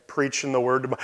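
ordinary delivery

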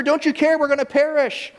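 high, loud desperate call over the storm; frightened disciples calling their master in the storm, high and desperate; panicked cry. Acted.

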